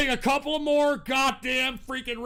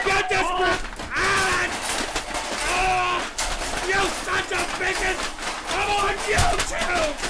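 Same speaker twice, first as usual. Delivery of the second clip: furious shouting; 4 semitones higher than usual. Real, not acted. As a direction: scream them out of the room. Host screams at callers.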